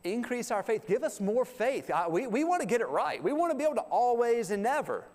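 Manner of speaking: high, earnest begging; pleading disciples. Retold or imitated. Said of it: "they go".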